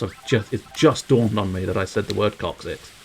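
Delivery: usual speaking voice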